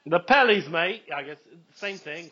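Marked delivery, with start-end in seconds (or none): 0.1-1.0 put-on light jokey Australian accent, a bit louder; mock Australian accent. Teasing his co-host about the nickname in a mock Australian accent. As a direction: toss off The Pellies, mate in a hammed Australian accent. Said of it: "I thought that was your Australian accent".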